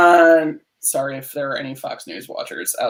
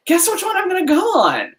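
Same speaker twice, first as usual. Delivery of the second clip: rhetorical high question; 14 semitones higher than usual. Real, not acted. Rhetorical punchline, pitch jumps high.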